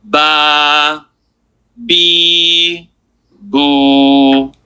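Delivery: "ba, bi, boo" each held long on a level note; stretched vowels. Shown as a demonstration. Teacher showing any letter takes the accent, long-held "ba, bi, boo". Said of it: "what letter I put here".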